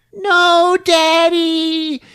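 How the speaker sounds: high, loud retold scream for daddy; child's scream. Recapping the scene, daughter's high loud cry. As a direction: cry out as the kid watching her dad fall. Daughter sees father killed.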